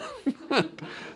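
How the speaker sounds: short high-pitched laugh in disbelief. Real, not acted. Laughing at doctors who burn the nerve, a high laugh. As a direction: laugh it off, amazed at the nonsense.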